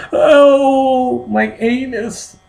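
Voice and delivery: high, drawn-out pained wail; hammed-up slow squeal of pain; mock agony. Man hurting during the bedroom scene, high pained wail.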